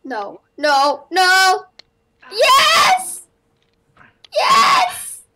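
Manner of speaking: building from loud no to very high loud yes; excited yelling. Real, not acted. Gamer nearly failing then succeeding, excited high yells. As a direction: despair flips to triumph.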